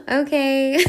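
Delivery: laughing in a high squeal; high laughter. Real, not acted. Laughing at her own betrayal story, high-pitched laugh.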